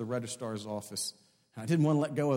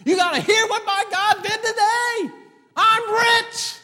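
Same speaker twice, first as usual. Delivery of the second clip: preacher's jubilant holler, pitched way up; exultant shouting; 13 dB louder than usual. Acted. Employee telling his boss what God did, shouting in triumph. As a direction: build to a high, full-voiced shout.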